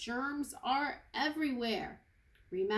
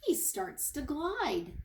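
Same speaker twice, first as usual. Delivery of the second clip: plain reading voice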